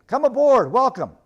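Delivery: loud, high call of welcome; shouted welcome. A narrator's reading. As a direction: hail him aboard at full voice. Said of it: "shouted Caspian".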